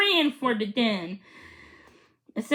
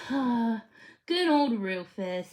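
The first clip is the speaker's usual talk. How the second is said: wistful; much slower than usual. A narrator's reading. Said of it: "a sad look came".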